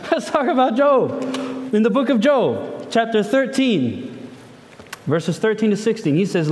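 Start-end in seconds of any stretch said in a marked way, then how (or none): none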